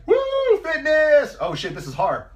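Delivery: high, loud whoop, then deflated; mock woo cheer. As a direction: cheer wildly, then crash. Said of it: "you're like".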